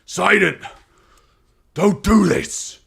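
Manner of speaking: high, loud, strained battle cry; desperate scream. Acted. Captain pleading with the enemy he is fighting, screaming. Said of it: "Captain screams. He's like".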